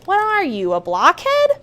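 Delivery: put-on a lady insulting the boy, scornful; scolding lady. A narrator's reading. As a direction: taunt him as a blockhead.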